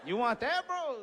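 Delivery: high rising challenge; high-pitched feeble taunt; mock bravado. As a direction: bluff a threat, voice climbing high. Bluffing challenge with injured hand.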